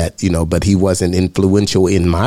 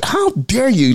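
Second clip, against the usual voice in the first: retold outrage; 13 semitones higher, slower than usual. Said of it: "I'm angry because he is enjoying".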